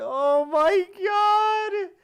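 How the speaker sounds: high, slow, loud shocked cry; shocked shout. Real, not acted. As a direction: yell it out in disbelief. Shocked reaction to the clip.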